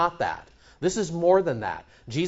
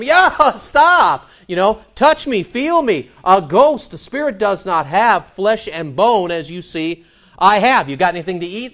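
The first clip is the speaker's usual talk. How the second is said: voicing Jesus; 5 semitones higher, 7 dB louder than usual. Jesus voiced reassuring frightened disciples.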